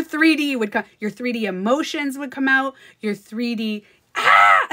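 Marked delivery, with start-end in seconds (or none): 4.1-4.7 sudden loud "ah!" as if slapped; acted burst of outrage; mock pained yell. Acting out a slapped friend's outburst.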